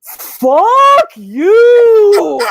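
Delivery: long, high, furious scream; Randy. Acted. Randy to the killer mocking him on the phone, furious scream. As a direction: scream it full out at the taunting caller. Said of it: "and he just goes".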